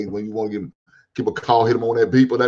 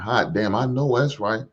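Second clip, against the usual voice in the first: normal talking voice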